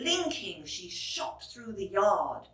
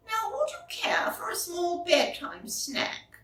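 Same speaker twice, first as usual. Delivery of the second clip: put-on high, whispered old lady; 9 semitones higher than usual. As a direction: whisper it like a kind granny.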